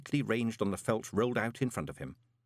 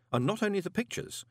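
ordinary delivery

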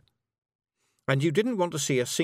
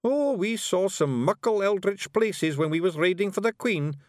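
high, loud wee Scots warrior voice; Scottish Feegle Rob; 9 semitones higher, 3 dB louder than usual. A narrator's reading. Rob Anybody brags to Miss Level, high Scots Feegle. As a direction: play a cocky little Feegle, high and brash.

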